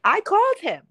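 high, loud confession; loud admission. Real, not acted. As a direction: admit it loudly with a laugh. Admits calling ex-boss.

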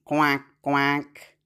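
low, lightly voiced duck quacks; a light quacking duck. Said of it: "Now, duck".

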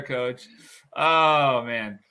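usual speaking voice

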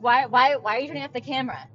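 loud, fast, demanding retold shout; imitating husband's yell. Retelling how her husband yelled about the camera, loud and fast.

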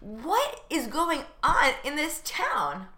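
drawn-out bewilderment. Acted. Exaggerated bewilderment.

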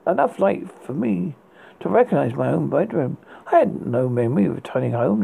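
ordinary delivery, nothing marked

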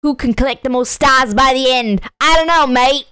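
put-on British reading, lifting at the end; cockney accent. Reading the game's question aloud, in mock cockney. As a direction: read it like a cockney game host. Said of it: "underground cockney you're saying".

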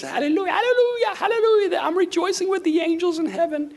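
piercingly high mock praise voice; fake rejoicing. Believer hiding bad times behind hallelujahs, high put-on praise. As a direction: parody a believer over-rejoicing through hard times. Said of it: "when things are really bad, we just".

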